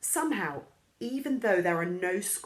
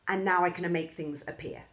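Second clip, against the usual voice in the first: plain reading voice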